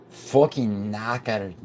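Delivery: put-on slow, drawn-out Scottish accent; Scottish-accented, savouring each word. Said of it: "anything but a Scottish accent".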